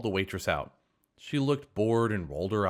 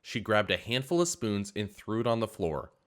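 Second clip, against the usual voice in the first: ordinary delivery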